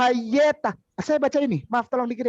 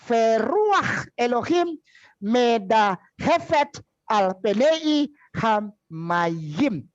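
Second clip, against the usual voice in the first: slow careful reading; 4 semitones higher, slower than usual. Shown as a demonstration. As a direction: read the Hebrew slowly, word by word, spacing each phrase. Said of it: "Saya bacain pelan-pelan lagi". (membaca pelan-pelan kata demi kata)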